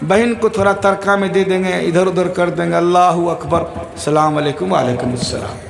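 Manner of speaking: low, quick muttered scheming; lightly voiced greedy son counting the inheritance; a son thinking about money at his father's funeral, low and fast; son's inner thoughts. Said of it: "وہ سوچ رہا ہے".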